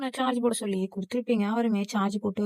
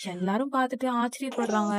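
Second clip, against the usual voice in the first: ordinary delivery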